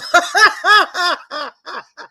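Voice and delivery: loud high cackling laugh; laughing fit. Real, not acted. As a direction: lose it laughing.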